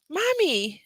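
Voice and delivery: put-on high child's voice, British accent. Cartoon child calling mother, British accent. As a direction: mimic a little British cartoon kid. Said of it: "British accent".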